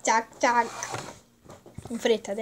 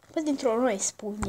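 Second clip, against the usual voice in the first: usual speaking voice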